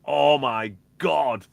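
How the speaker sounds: loud, drawn-out retold amazement; slow, booming re-enacted disbelief; awestruck exclamation. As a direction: stretch it out as a stunned realisation. Retold amazement at the combined track.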